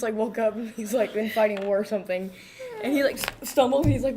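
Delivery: normal talking voice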